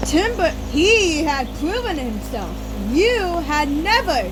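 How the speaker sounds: loud, high sharp retort; angry loud comeback; shooting back. A narrator's reading. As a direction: fire it straight back. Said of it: "he shot back".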